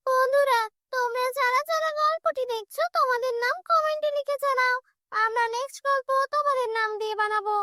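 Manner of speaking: high-pitched, upbeat address to viewers; viewer call-out. Real, not acted.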